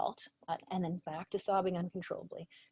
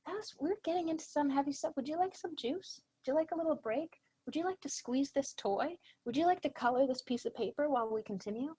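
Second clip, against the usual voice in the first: put-on higher, gentle voice offering a child a break; gentle therapist voice; 6 semitones higher than usual. Shown as a demonstration. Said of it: "So we can say".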